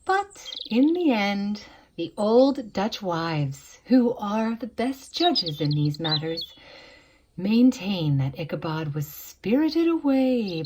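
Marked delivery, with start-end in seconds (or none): none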